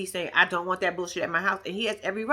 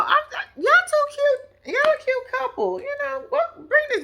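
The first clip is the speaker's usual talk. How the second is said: much higher, laughing coo over the couple; gushing. Real, not acted. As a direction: squeal at how cute they are.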